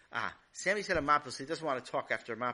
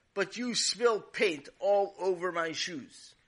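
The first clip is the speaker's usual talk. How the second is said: slow, louder, higher accusation; indignant complaint; 5 semitones higher, 6 dB louder, slower than usual. Acted. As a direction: drag it out, aggrieved. Neighbor angry about paint.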